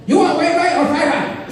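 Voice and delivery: high-pitched mock takeout counter voice; restaurant worker. Worker asking a teriyaki customer about rice, high put-on voice. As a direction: push the order question out in a high caricature. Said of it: "when you go to them".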